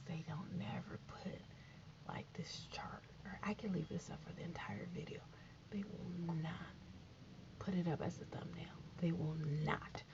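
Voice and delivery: low whispered aside, a bit fast; whispered grumble. Real, not acted. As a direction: complain in an unvoiced whisper. Grumbling aside about the chart.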